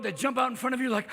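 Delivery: normal talking voice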